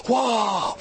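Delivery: drawn-out shouted mock wow; hammed-up awestruck exclamation; amazed exclamation. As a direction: stretch it out in imitated amazement. Acting out the student's amazement again.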